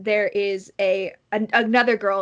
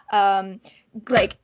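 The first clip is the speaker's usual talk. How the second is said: normal talking voice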